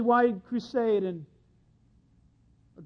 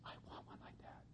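whispered, secretive wanting; barely audible, voiceless whisper; covetous whisper; 28 dB quieter, faster than usual. Acted. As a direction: whisper it as if eyeing a neighbour's things. Voicing a coveter eyeing possessions.

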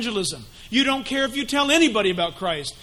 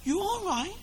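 soft, high, slow coaxing murmur; softer sly devil reassuring; soothing tempter; 7 semitones higher, 11 dB quieter, slower than usual. Acted. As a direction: hush them into it sweetly. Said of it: "Satan, he's just".

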